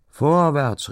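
high, loud cry of go on; calling out. A narrator's reading. Geest men urge the game on with a loud high call. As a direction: shout them onward. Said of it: "riefen die Geestleute".

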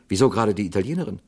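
the actor defends the Italian woman, high and insistent; agitated actor. Acted. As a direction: argue it, mounting in pitch. Actor argues the alibi, heated.

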